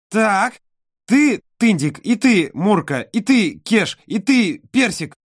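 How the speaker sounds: put-on high, loud little girl counting; little girl listing. A narrator's reading. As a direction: count off toys like an eager child. Olka counts her toys.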